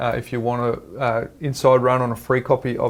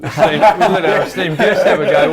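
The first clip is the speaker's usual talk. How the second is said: laughing tease; 6 semitones higher, 6 dB louder, slower than usual. Real, not acted. Teasing guest, laughing.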